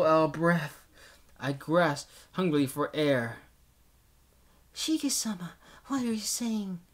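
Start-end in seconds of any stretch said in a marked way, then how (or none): 4.7-6.8 gentle young maid, voiced high; polite girl servant voice.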